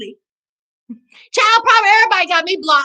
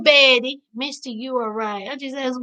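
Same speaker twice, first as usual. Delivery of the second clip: usual speaking voice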